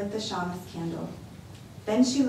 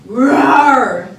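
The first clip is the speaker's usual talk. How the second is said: loud, higher roaring bellow; a big loud bear's roar; 4 semitones higher, 16 dB louder than usual. Acted. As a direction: roar like an angry bear. Bear roars answering her.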